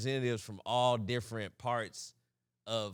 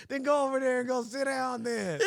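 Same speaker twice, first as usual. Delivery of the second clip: imitating an exasperated coach, pitched up; coach dismissing; 10 semitones higher, 4 dB louder than usual. Coach sends quitter to sit, mock exasperation. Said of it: "that's our coach would talk like".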